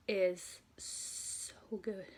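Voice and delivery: very slow, drawn-out "is so good"; swooning over the new album; savoring praise. Real, not acted. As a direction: linger on each word like tasting it. Raving about the album.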